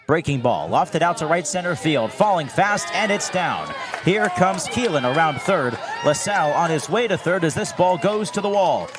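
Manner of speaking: excited play-by-play. Real, not acted. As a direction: call the big hit with rising energy. Home run call excitement.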